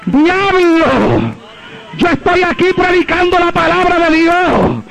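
high-pitched commanding preacher's cry; deliverance prayer. Retold or imitated. Preacher orders the devil off his child, high commanding prayer. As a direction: re-enact casting out the devil, drawing out the key words. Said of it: "lancé una oración en el nombre de Jesucristo".

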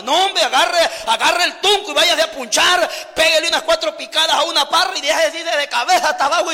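loud preaching rebuke to lazy husbands; scolding shout. Acted. Preacher scolding lazy husbands, loud and fierce. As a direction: bark at the lazy husbands to get to work.

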